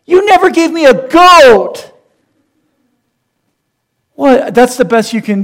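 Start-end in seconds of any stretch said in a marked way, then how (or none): 0.0-1.9 much higher, louder, slow whine; hammed-up whine. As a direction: play the grand grievance for laughs. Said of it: "the big nuclear bomb in the argument".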